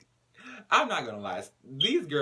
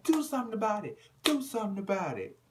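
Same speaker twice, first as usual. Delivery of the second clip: taunting; 9 semitones higher than usual. Retold or imitated. Judah challenging.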